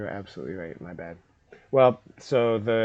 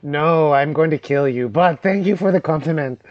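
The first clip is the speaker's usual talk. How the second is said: goblin voice; 4 semitones higher, 7 dB louder than usual. Acted. Goblin flattered then threatening.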